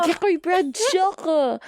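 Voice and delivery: slow, high mock-French exclamation; French accent. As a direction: draw out the name in hammed-up French. Hammed-up French bookseller calling her friend.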